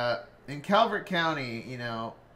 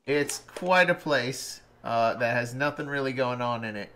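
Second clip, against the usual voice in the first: usual speaking voice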